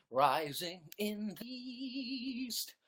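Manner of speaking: slow, drawn-out epic proclamation; dramatic intoning. Pretend. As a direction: intone it like a movie trailer. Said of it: "dramatic intoned line".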